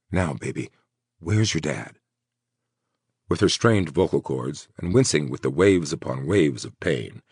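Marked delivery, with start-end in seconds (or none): 0.1-2.0 gentle coaxing. A narrator's reading. Gently coaxing hurt woman.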